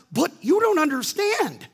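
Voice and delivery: high, loud whine; protesting. Retold or imitated. Preacher arguing back to God, high and protesting. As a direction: object like a stubborn child. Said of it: "And I'm like".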